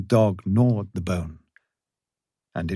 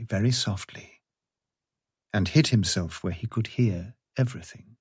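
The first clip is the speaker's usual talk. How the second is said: ordinary delivery, nothing marked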